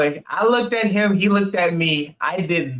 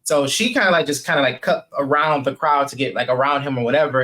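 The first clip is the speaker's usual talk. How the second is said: ordinary talk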